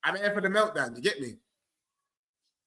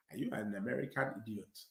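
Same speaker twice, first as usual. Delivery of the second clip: much lower, quiet African accent; 7 semitones lower, 15 dB quieter than usual. Shown as a demonstration. Football fan demonstrating his put-on accents, African accent. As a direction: drop low and soft into an African accent. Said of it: "With the African accent is".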